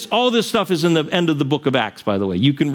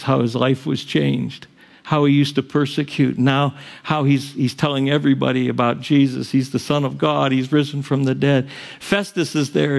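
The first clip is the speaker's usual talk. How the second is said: ordinary delivery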